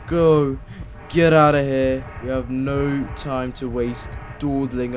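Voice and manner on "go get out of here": loud urgent command to himself; urgent yell. Real, not acted. Player escaping the monster, urgent loud self-command. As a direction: shout yourself into running.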